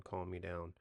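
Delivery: ordinary delivery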